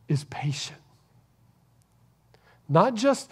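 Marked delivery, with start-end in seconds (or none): 0.1-0.8 hushed whisper. Real, not acted. Preacher to congregation about Jesus, whispered. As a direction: drop to a reverent whisper. Sermon hush on Jesus' patience.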